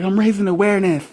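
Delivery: high, loud goofy announcement; mock campaigner. Pretending to campaign in a helmet, loud goofy proclamation. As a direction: ham up a proud awareness campaigner. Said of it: "Be like".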